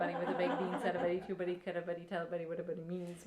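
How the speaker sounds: tongue-twister sprint trailing off; fast Newfoundland rhyme. Shown as a demonstration. Reciting a Newfoundland friend's rhyme, very fast. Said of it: "she'd say it so fast".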